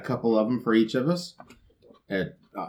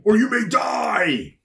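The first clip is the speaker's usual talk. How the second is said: spooky shout; 9 semitones higher than usual. Acted. Horror tagline punchline.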